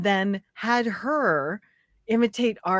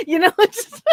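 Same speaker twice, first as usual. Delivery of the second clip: high, loud laughing through the words; 10 semitones higher, 5 dB louder than usual. Real, not acted. Friend laughs about polite British insults, laughing hard. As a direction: laugh it out helplessly.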